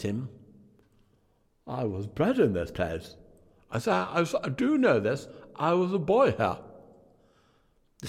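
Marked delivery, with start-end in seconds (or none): 1.7-6.6 higher, louder wondering cry; excited old Scrooge. A narrator's reading. Scrooge to the spirit, seeing his birthplace, excited. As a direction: marvel at coming home.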